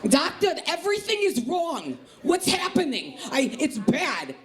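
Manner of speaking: high, panicking East Coast aunt; Aunt Sherry. Acted. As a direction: wail to the doctor in high-pitched alarm. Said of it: "That's what she sounds like".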